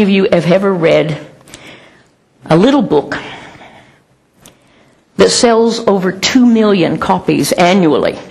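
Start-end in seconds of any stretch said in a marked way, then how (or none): none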